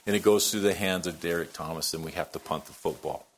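usual speaking voice

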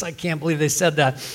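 normal talking voice

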